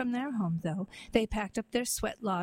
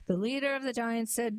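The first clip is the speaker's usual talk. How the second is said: ordinary delivery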